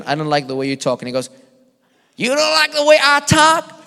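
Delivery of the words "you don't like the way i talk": much higher, louder put-on preacher accent; David Hogan's accent. Preacher snapping back at a critic in a loud, high accent. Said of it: "but he has this accent".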